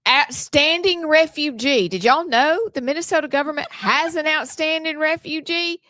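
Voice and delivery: a lightly put-on Southern accent; mild Southern newsreader accent. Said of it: "in my real Southern accent".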